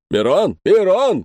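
calling after like to a dog; loud call after someone fleeing. A narrator's reading. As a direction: Frenchman shouting the name from behind. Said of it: "кричал сзади Галуа".

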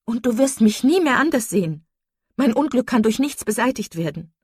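high, loud declaration of misery; despairing outburst. A narrator's reading. Marian tells her sister nothing can end her grief, despairing outburst. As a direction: cry out in hopeless anguish.

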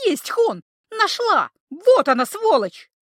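gleeful shout of victory; triumphant. A narrator's reading. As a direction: crow over the find. Triumphant find of the doll.

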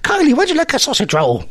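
high-pitched, put-on Irish lilt; weird Irish accent. Host offering Kylie a sausage roll, weird mock-Irish accent. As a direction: coax them in a daft mock-Irish accent. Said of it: "talking in that weird Irish accent".

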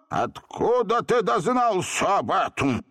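slow, high astonished question; amazed. A narrator's reading. Genie hearing his brother named, amazed. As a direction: stretch it in disbelief. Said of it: "поразился в свою очередь".

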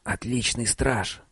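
soft hushed whisper. Acted. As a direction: whisper it close, slowly. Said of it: "прошептал Биллу".

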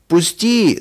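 high-pitched demand to be released; yelling. A narrator's reading. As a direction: cry out to break free. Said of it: "закричал Женя".

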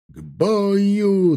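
high, drawn-out command shout; battle cry. A narrator's reading. Major calling his men to battle, shouting. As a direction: call the troops to arms. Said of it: "донёсся крик майора Дементьева".

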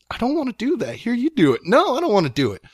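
high-pitched mock squabble, refusing; reluctant refusal. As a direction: retell two siblings passing off a dreaded job, voice high. Acted squabble with sister over shooting.

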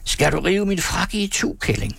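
acted angry shriek. A narrator's reading. Man with torn coat at his fiancée, high angry cry. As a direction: screech the accusation in mock fury. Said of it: "skreg han".

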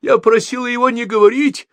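high, upset cry; shouting in protest. A narrator's reading. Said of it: "закричала Софи". (высокий протестующий крик)

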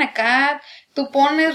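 normal talking voice

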